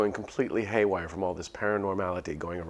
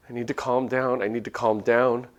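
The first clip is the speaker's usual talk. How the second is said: 1 rising panic; 4 semitones higher, 7 dB louder than usual. Acted.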